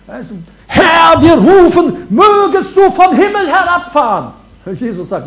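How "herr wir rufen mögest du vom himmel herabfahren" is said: high, loud, pompous cry to heaven; hammed-up loud intercession; mock shouted prayer. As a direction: bellow it like an overblown prayer meeting.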